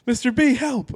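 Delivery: high, whiny cry for help; mock plea. Teen begging dad's friend for help while hauled off, mock plea. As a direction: squeal for help like a scared kid. Kid begging as he's led away.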